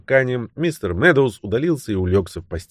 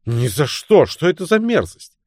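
disgusted cry, leaping higher at the end; exclaiming; 6 semitones higher than usual. A narrator's reading. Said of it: "и вскричал". (возмущённое восклицание)

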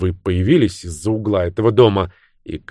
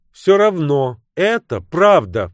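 high, drawn-out heated insistence; flaring retort; 10 semitones higher, 4 dB louder, slower than usual. A narrator's reading. Bridget insists against Luke's polite jab, flaring up. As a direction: fire back hotly with the pitch up. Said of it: "вспыхнув, парировала Бриджит".

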